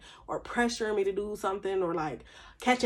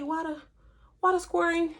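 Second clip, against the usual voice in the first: usual speaking voice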